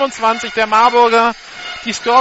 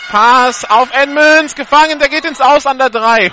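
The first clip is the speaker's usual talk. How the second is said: excited play-by-play; 5 semitones higher, 8 dB louder than usual. Real, not acted. Live call of a caught pass.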